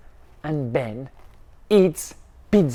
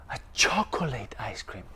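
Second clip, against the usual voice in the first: lower, soft and quick, almost to yourself; hushed awe; 7 semitones lower, 10 dB quieter, faster than usual. Acted. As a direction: savour it in a hush. Repeated in awe.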